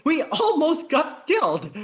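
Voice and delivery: exasperated outburst. Acted. Lieutenant protests to the captain, high exasperated outburst.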